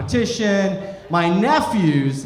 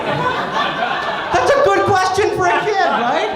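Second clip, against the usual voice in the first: much higher, slow, faux-impressed; mock-earnest high aside; 12 semitones higher, slower than usual. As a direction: play it sweet and impressed, high.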